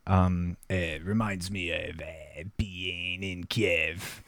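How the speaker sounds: hammed-up Russian accent, drawn out. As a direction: begin a solemn Russian monologue. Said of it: "is it okay if I do the monologue".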